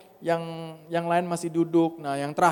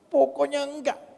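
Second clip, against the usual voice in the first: higher, insistent "no"; stubborn refusal; 11 semitones higher than usual. Retold or imitated. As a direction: dig in your heels. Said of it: "saya bilang gitu". (penolakan keras kepala)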